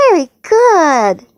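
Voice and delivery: slow, high, swooping praise; delighted praise. Real, not acted. Parent praising a child's alligator, delighted.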